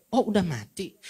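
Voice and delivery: casual "oh, he's dead", as if nothing happened; offhand indifference. Retold or imitated. Nurse noting a patient's death, mildly offhand. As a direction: toss it off like the death means nothing. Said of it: "Cara ngomongnya itu kayak ayam yang mati saja".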